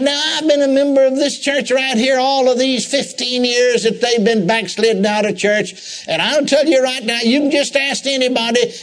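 high, whiny mock crying; self-pity whine. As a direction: whimper like a sulking churchgoer. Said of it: "the poor dear just began to cry".

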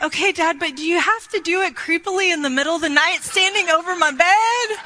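re-enacted teenage complaint, rising high at the end; exasperated protest. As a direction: protest to dad, building to a high finish. Daughter protests to her dad.

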